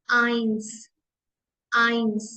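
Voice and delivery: आइंस said "ines" with an ai glide; आइंस repeated as a classroom drill; German eins. Shown as a demonstration. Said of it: "one को हम pronounce करेंगे आइंस".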